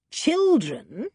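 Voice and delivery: a sharp rising surprised question; startled villain, mildly voiced; taken aback. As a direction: catch him off guard with the news. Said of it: "taken aback".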